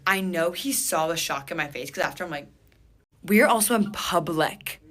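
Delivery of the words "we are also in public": raised, loud protest; indignant. Retold or imitated. Woman objecting to a surprise kiss, indignant. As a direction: push it out as an objection.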